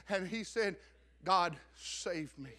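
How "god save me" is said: slow pleading prayer. Retold or imitated. Quoting convert's final plea.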